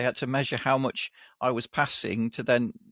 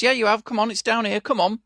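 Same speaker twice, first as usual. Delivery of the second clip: mock porter, higher and louder, brushing him off; imitating pushy porter; 9 semitones higher, 4 dB louder than usual. Porter to confused patient, brusque mock insistence.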